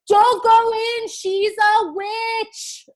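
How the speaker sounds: very high, loud, slow squeaky warning; little chicken crying out a warning; urgent chicken warning. Acted. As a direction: shriek out a warning to the children.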